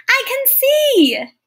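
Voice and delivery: high, slow, amazed cry of joy; joyful exclamation. A narrator's reading. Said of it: "the bison exclaimed".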